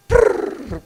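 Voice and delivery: monkey chatter brrr. Retold or imitated. Monkeys fleeing up a tree.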